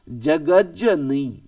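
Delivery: जगत जननी drawn out very slowly, every syllable clear; slow spelling aloud. Shown as a demonstration. Teacher slowly spells out जगत जननी for children. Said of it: "याद देखो".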